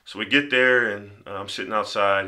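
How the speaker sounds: ordinary delivery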